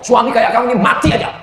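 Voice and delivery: high, fast mock scolding wife; mock angry wife. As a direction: snap at husband in jest. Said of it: "kalau ada istri yang ngomong".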